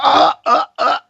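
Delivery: high, loud repeated "ah"; mock awed "ah". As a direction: cry out "ah" in short bursts, high-pitched. Imagined awe at seeing waves.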